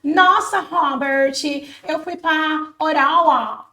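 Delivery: hammed-up pretentious language mixer, drawn out; mock a show-off dropping English words into Portuguese; slow, affected showing off; show-off mockery. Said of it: "Não vai ficar aquele babacão que quer".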